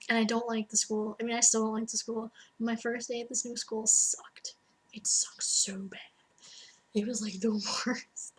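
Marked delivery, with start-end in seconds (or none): 3.9-8.3 hushed whisper, slower on the second line; whispered complaint. Real, not acted. Telling viewers about the awful new school, whispered. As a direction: breathe it out like a confession.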